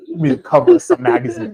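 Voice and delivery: picture the editor gushing over you; high, giddy fantasy praise; imagined hype. Retold or imitated. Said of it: "he's about to be like".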